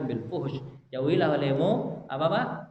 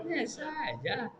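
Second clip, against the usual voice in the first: higher, slow and soft, dismissive; mocking drawled brush-off; 9 semitones higher, 6 dB quieter, slower than usual. Preacher mocks a careless man, slow and dismissive. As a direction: drag it out like it's nothing.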